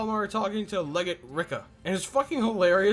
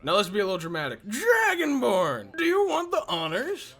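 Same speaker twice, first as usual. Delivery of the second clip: mock dramatic Ulfric. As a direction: draw it out big and theatrical. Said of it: "Then Ulfric is like".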